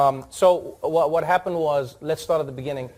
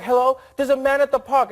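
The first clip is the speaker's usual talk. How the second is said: caller voice; 10 semitones higher, 4 dB louder than usual. Acted. Acting out a 911 call.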